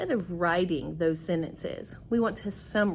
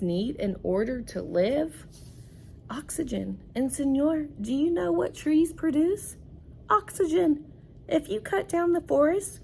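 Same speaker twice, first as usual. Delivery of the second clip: rising urgent read-aloud porcupine; reading to children; 6 semitones higher than usual. Acted. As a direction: climb higher with each oxygen as the porcupine. Teacher reads porcupine's argument.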